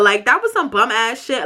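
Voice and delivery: usual speaking voice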